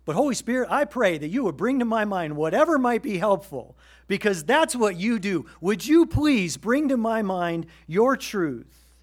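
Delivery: earnest loud plea to the Holy Spirit; fervent prayer. Retold or imitated. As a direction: cry out for help, slowing on the last line. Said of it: "I'm like".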